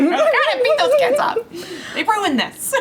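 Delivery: high, laughing fake fury; laughing mock outrage. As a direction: cackle through a joking threat. Joking about beating teens up.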